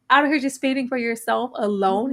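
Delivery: ordinary talk, nothing marked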